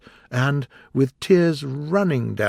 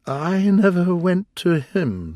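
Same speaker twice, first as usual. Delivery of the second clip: high, slow sighing; 8 semitones higher, 4 dB louder, slower than usual. A narrator's reading. Mock Turtle to Gryphon, sighing high and slow. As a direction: sigh it out wistfully. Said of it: "said with a sigh".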